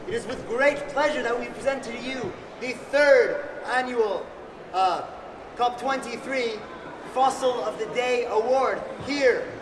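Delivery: grand multilingual ring announcer; lucha libre announcer. Acted. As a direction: announce it like a wrestling emcee. Opens the award ceremony grandly.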